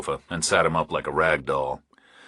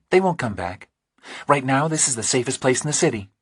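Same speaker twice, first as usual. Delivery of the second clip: higher, lighter woman's voice, unhurried; Dee Marie's voice; 6 semitones higher than usual. A narrator's reading. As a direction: read her as a steady, practical woman.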